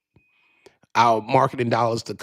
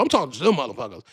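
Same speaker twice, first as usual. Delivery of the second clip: ordinary talk, nothing marked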